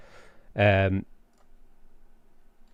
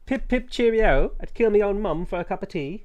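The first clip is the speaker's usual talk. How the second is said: hammed-up posh British accent, pitched higher; mock British accent; 8 semitones higher than usual. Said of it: "I'm revealing my true accent".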